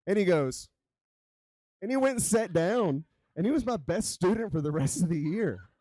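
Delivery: high, loud, laughing through the punchline; laughing while telling. Real, not acted. Telling the audience the payoff of her story, laughing and loud. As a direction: laugh through the words as the crowd reacts.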